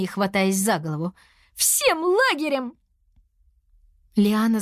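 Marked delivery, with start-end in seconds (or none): 1.5-2.7 high, drawn-out dismay; horrified wail. A narrator's reading. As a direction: stretch it out in horror. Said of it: "завопила Кира в ужасе". (в ужасе, растягивая слова)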